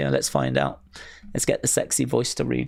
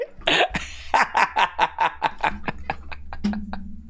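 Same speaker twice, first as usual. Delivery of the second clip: quiet laugh; 4 semitones higher, 4 dB quieter than usual. Real, not acted. Laughter fades out.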